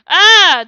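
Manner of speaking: short, loud, high scream. A narrator's reading. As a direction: cry out "Ah!" at full voice.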